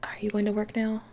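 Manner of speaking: usual speaking voice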